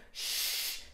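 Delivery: hissed, hushing sound; long shush. A narrator's reading. Busy parents put Daisy off, shushing. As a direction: hush a bothersome child.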